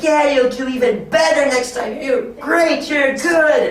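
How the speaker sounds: high, gushing encouragement; excited praise. Pretend. Teacher to a kid who wins, excited high praise. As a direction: praise them in a high, cheering voice.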